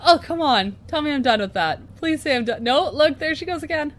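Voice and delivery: high, loud mock despair; exasperated pleading. Real, not acted. Vlogger to viewers, her head blocks shot again, exasperated. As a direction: beg it to be over.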